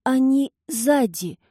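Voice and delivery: high, slow feeble murmur; weak old voice. Acted. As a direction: mumble like a frail old man. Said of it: "пробормотал тот слабым старческим голосом".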